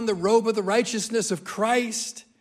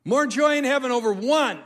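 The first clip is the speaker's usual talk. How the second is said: 1 emphatic repeat; 6 semitones higher, 6 dB louder than usual. Real, not acted. Preacher repeats key line emphatically.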